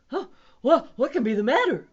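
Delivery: high, loud, flustered keeper; worried zookeeper's cry; crying out in alarm. A narrator's reading. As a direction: blurt it out in a panic. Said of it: "cried the keeper".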